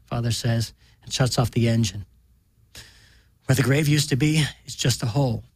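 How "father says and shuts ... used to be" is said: ordinary delivery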